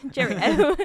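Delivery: playful mock British accent, laughing through it. Answering a friend's cheerio in a British accent. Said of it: "British accents are just fun to do".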